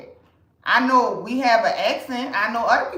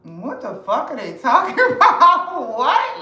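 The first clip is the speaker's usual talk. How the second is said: laughing disbelief; 4 semitones higher, 5 dB louder than usual. Retold or imitated. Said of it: "And I was just thinking".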